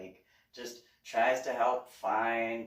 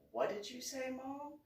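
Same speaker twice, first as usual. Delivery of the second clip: put-on mild teen voice; 12 semitones higher, 7 dB quieter than usual. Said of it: "he goes".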